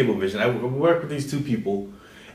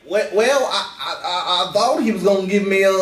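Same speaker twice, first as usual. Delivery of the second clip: stammering mock Southern accent, pitched up; higher-pitched imitation Southern accent; 6 semitones higher than usual. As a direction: put on a Southerner's stammering protest. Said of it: "why am I speaking in Southern accent".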